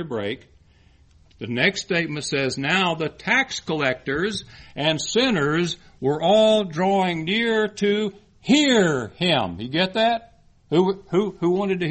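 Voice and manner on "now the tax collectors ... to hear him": slow, raised preaching delivery, stretched key words; emphatic scripture reading. Acted. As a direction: read it out slowly, building to a loud finish. Reads scripture aloud with emphasis.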